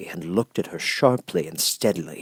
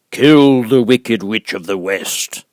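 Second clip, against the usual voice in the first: booming, much louder, very slow voice of the giant head; great and terrible Oz; 2 semitones higher, 9 dB louder, slower than usual. Acted. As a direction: loom over her, slow and thundering. Oz commands.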